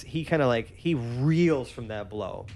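ordinary talk, nothing marked

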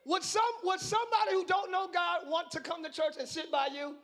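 loud, high, fervent preaching; preaching at full force. Acted. Preacher rousing a sleepy congregation, loud and fervent. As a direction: pour it out over the congregation.

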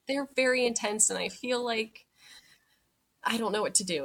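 lightly acted upset and unsure; retold tearful phone plea. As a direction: imitate fretting on the phone, voice lifted. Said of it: "I just start crying".

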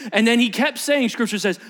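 ordinary delivery

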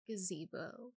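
very soft, low coo; imitated cooing. Co-host imitating someone cooing the child's name, softly cooed. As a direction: coo the name under your breath, imitating. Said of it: "cooing his name".

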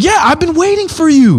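as if he expected you all along; a lightly voiced patient king; throne man's welcome. Man on throne greets dreamer.

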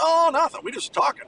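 high, innocent brush-off to the coach; innocent high reply. Retold or imitated. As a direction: squeak out an innocent answer.

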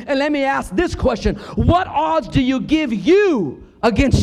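loud, high, slow fiery sermon; preaching at full force. Acted. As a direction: rouse the congregation with every word.

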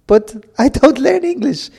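high-pitched mock Spanish accent. Colleague Marcial complaining about the podcast, in a high mock Spanish accent. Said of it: "I won't do it with the accent".

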